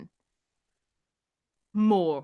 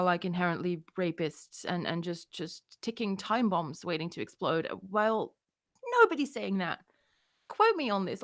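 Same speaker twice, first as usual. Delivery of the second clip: ordinary talk, nothing marked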